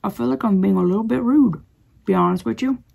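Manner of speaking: chewing sticky toffee; mouth full. Real, not acted. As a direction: talk round a chewy bite. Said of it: "It's taken a long time to chew it".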